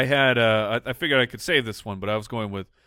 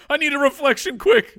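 laughing; 13 semitones higher, 6 dB louder than usual. Real, not acted. Joking about mirror, laughing.

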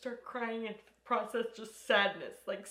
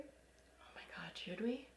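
unvoiced giddy whisper; 17 dB quieter, slower than usual. Real, not acted. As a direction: whisper it like a secret. Nervous whisper before smoking.

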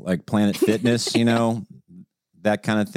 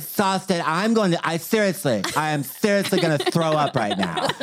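higher, mock-exhausted whine; dramatic wife imitation; 7 semitones higher than usual. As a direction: overplay being worn out. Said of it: "She's been so dramatic lately".

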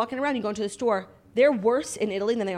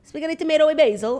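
louder, higher waiter correction; mock Italian waiter; 7 semitones higher, 7 dB louder than usual. Retelling Rome waiters, mock indignant. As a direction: mimic a snooty waiter's correction. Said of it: "They're like".

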